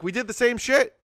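high-pitched aggrieved whine; mock outrage at unfair loot; exasperated complaint. Retold raid loot frustration.

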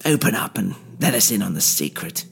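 oily schemer toying with the hero; smug villain Tooley. Acted. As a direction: purr like a gloating villain. Tooley's voice, held in narration.

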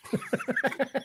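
short, quiet chuckle; soft real laugh. Real, not acted. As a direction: chuckle at your own punchline. Laughs after bald patch joke.

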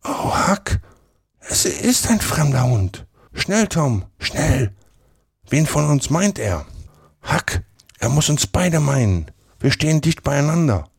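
high, frightened, plaintive half-whisper; pitiful whisper. A narrator's reading. Tom tells Huck it's a strange dog, pitiful whisper. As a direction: plead pitifully in a high hushed voice. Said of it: "Der Ton seiner Stimme war erbarmungswürdig, als er jetzt flüsterte".

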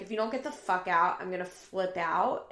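usual speaking voice

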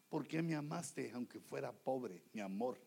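low, soft sweet-talk; caressing husband. Acted. Husband soothes his wife about being poor, low and tender. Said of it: "Sino que acariciarla".

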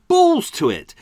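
high, loud dismissal; exasperated outburst. Real, not acted. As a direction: throw it out loudly, giving up. Gives up on the clip.

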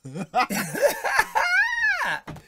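long, high, loud cackle; real helpless peal of laughter; shrieking laugh. Real, not acted. Host unable to read the comment out, shrieking laugh.

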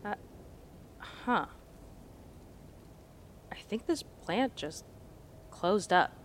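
slow hushed whisper; whisper, afraid to wake it. Acted. Hushed near dangerous plant.

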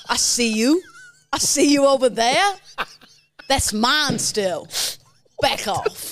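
put-on London roadman accent. Juice head at the gym to a bench thief, London roadman accent. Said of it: "forty-four accents in one".

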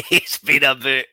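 slow, laughing repeat; laughing echo. Real, not acted. Host echoing the player's half-finished line, laughing. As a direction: echo his words through a chuckle. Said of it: "repeats, laughing".

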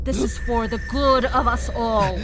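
zealous cult leader declaring victory; fervent sermon. Acted. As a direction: preach it to the crowd at full force. Leader preaching over the carnage.

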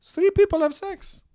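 high mock Russian accent; high and incredulous, Russian girl; a high, stunned Russian voice. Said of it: "She's like".

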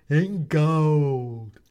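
sinister hooded stranger; hooded figure. A narrator's reading. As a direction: speak like a menacing cloaked figure.

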